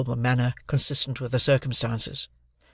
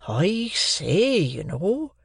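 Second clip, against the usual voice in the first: Joe's earnest, higher-pitched rustic voice; serious remonstrance; 6 semitones higher, much slower than usual. A narrator's reading. Joe cautioning young Pip, in serious remonstrance. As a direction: remonstrate with swooping pitch, not truly muttered. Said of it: "muttered Joe, shaking his head at me in very serious remonstrance".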